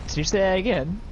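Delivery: ordinary talk, nothing marked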